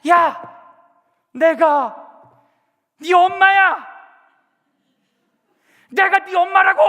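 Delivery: exploding mother, shouting in full voice; furious shout. Retold or imitated. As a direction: bang the sink and scream it at the child. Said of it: "쾅 치면서 외치는 거예요".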